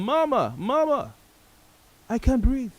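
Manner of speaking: high, pleading cry for his mother; calling for mama. Retold or imitated. Retelling Floyd calling his mother, high pleading cry. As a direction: call out to mama in a high, desperate voice. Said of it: "He was calling his mom".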